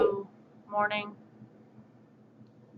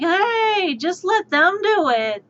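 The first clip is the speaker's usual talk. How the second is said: coworkers' voice; 7 semitones higher, 4 dB louder, slower than usual. Retold or imitated. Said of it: "So it was like".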